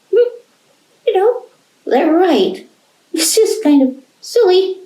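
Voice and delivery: very high tickled cat voice; amused cat. Acted. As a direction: giggle it out high, about to laugh. Cat agrees it's silly.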